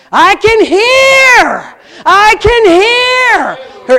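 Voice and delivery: high, loud, joyful cry of disbelief; ecstatic shout. Retold or imitated. Healed girl cries out to the youth group, ecstatic shout. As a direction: shout it out as hearing comes back.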